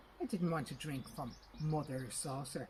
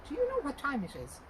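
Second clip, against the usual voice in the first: indignant; 10 semitones higher, 7 dB louder than usual. A narrator's reading. Indignant father woken early.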